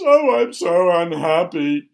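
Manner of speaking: loud, drawn-out mock wail; theatrical sobbing king. A narrator's reading. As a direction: wail it slowly, hammed-up misery. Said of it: "he keeps sobbing over and over again".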